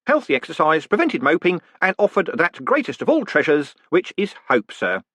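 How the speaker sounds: high, bright-voiced jolly warder; cheerful warder. Warder reporting the prisoner's words to the condemned man, cheerfully. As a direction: play the polite prison warder chatting brightly.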